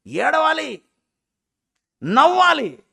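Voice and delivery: high, loud preaching cry; emphatic shout. Real, not acted. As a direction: punch each command out loudly with a pause between. Preacher exhorts the congregation. (గట్టిగా అరిచి చెప్పడం)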